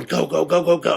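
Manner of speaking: high, urgent imitated panic; panicked urging. Frankie fleeing down the stairs, retold in high panic. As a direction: rattle it out like an escape order.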